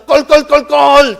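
loud, high, drawn-out mock guffaw; booming pretend laugh, stretched out; imitated hearty laughter. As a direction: spell out a big belly laugh slowly. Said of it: "기분 좋아서 참되게 웃고".

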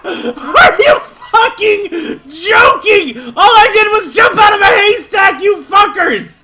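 high, loud, drawn-out rage; stretched words at full volume; outraged screaming. Real, not acted. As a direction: scream in disbelief at unfair treatment. Raging at game guards.

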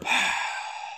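long sigh after losing; deflated sigh. Real, not acted. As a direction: breathe out the frustration slowly.

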